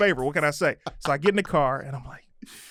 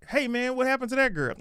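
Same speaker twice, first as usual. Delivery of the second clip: a lightly voiced, much higher buddy greeting; imitating old Doughboy; 9 semitones higher than usual. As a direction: play the old smooth-talking Doughboy, pitched up. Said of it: "the old Doughboy, was very".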